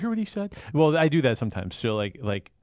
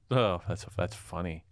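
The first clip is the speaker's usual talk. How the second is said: normal talking voice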